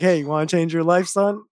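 ordinary talk

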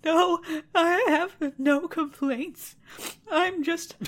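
high, slow, tearful wail; crying princess. Acted. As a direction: whimper it out like a weepy princess. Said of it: "And she says".

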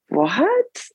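high, rising disbelieving what; shocked. Retold or imitated. Mother reacting to the doctor's test results, shocked. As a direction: recall stunned disbelief, pitch sweeping upward. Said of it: "I was just in shock".

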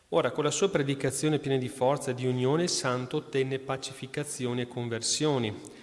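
lettura solenne, ritmo regolare; lettura agiografica. Acted. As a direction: racconta la vita del santo al pubblico. Reads saint's life aloud. (lettura devota ad alta voce)

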